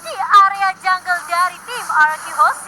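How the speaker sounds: excited commentary. Real, not acted. Caster builds up a duel.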